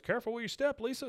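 high-pitched imitated warning; cautioning husband. Husband warns wife in retold scene.

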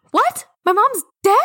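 high-pitched mock shock, gasping; badly faked tearful disbelief, very high; fake crying. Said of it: "Let me fake cry".

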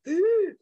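high startled gasp as anxiety hits; sudden sharp intake of breath. Retold or imitated. Hit by anxiety back in L A, a sharp high gasp. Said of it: "And I was like".

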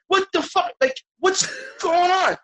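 high, loud disbelieving cry; baffled outburst. Retold or imitated. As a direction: exclaim in stunned confusion, drawn out. Baffled by the jumping movie.

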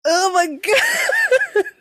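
high, slow shocked laugh; shocked laugh-shout. Real, not acted. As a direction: cry out half laughing. Startled by a sudden creepy voice.

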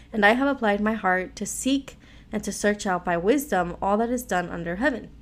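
normal talking voice

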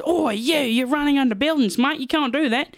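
high-pitched mock scolding; telling-off teacher. Teacher catches boy under the buildings, mock scolding.

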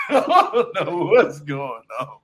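slow, low words melting into laughter; laughing it out. Real, not acted. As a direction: give up and laugh through it. Laughing at own country's mess.

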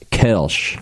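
Kelsch with an "eh"-like vowel, ending sh; tentative "kelsh" for Kelsch; attempted correct pronunciation. Shown as a demonstration. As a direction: try Kelsch carefully, as one slow syllable. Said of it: "Kolsch in English is something like".

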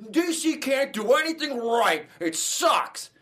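high, slow, loud fan rant; angry fan rant. Retold or imitated. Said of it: "everyone's like".

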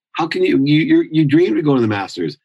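can't believe what he's hearing; stunned question to his best friend; disbelief. Retold or imitated. Shocked reply to his friend.

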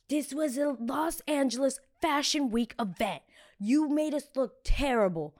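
high, slow, drawn-out mock scolding; imitated furious manager. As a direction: scold slowly in a put-on high voice. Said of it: "Got furious with me".

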